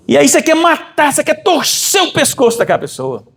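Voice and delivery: impassioned sermon climax; fiery preaching. Acted. Preaching builds to a peak.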